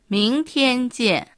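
slow, clear model pronunciation of 明天见; teacher's slow demo of 明天见; slow model phrase. Shown as a demonstration. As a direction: say 明天见 slowly for learners to copy. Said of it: "また明日は、".

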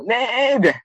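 ネイビャン at a very high, long stretched pitch; very far, very long. Shown as a demonstration. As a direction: sing ネイビャン high and long to show two kilometres. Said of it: "二キロメートルだと".

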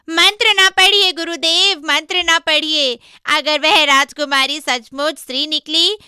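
high, very loud begging; desperate pleading. Acted. Monkey begging master not to recite the mantra, pleading. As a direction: beg urgently at the top of the voice. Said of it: "गिड़गिड़ा कर बोला".

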